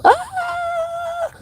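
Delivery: sudden high startled shriek; short yelp jumping far above the voice; imitated scream. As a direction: mimic the mom's frightened cry as she grabs the handle. Mom grabbing the handle in fright.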